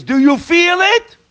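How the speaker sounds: high, drawn-out probing question; pointed challenge. Real, not acted. Preacher confronts listeners about whether they feel it, challenging.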